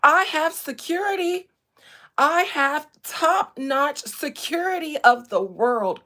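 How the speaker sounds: loud, high mock indignation; imitated young streamer. Streamer imitating a young man bragging of security, indignant. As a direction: mimic a privileged kid boasting about security. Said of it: "exactly what I heard that young man say".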